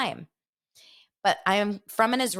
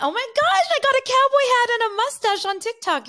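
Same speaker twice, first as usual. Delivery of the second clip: squeaky high cartoonish read, louder; silly high voice; 6 dB louder than usual. Reading a funny TikTok comment to viewers in a squeaky high voice. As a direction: pitch it way up like a goofy character.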